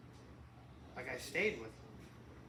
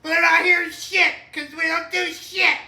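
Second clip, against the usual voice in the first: high, loud laughing rant; ranting shout; 12 semitones higher, 16 dB louder, slower than usual. Pretend. Mock rant that the government hears nothing, shouting. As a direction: scream it like a conspiracy nut.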